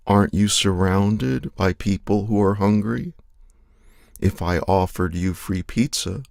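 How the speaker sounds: ordinary delivery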